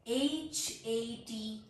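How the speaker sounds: slow letter-by-letter spelling. Shown as a demonstration. Teacher spelling a word for learners, slow spelling. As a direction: spell it out, a small gap after each letter. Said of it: "letter spell करती हूँ".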